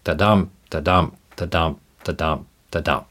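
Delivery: da-dum tapped out softly, stress on every dum; soft metronome chant of "da-dum", second beat stressed; iambic rhythm. Shown as a demonstration. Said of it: "the accent's on the second syllable of the pair".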